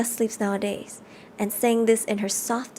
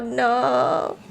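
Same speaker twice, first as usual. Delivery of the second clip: ordinary delivery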